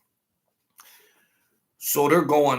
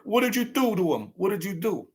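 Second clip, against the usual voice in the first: higher, retold mock yell; hammed-up accusing yell, not full force; mock scream; 9 semitones higher, faster than usual. Jealous buddy yelling at the stripper, mock scream. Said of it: "now he's screaming at her".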